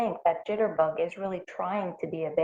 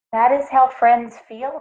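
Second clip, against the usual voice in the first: higher, louder character voice; lightly voiced Duffles; Duffles' voice; 7 semitones higher, 8 dB louder than usual. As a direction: say it like a wise friend.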